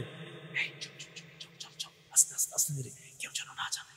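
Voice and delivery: hushed whisper of brothers sneaking out. Shown as a demonstration. Preacher whispers the brothers sneaking to the garden. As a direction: breathe the words, barely voiced. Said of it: "তাখাফাতুনের অনুবাদটা কেমনে বুঝাই?".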